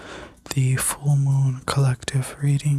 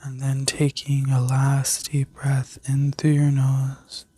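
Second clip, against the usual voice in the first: sleep story narrator. Acted. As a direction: guide them gently into rest. Leads a guided healing meditation.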